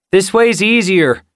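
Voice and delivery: high, loud call across the rocks. A narrator's reading. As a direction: call it out up the slope. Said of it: "Tall Tale called".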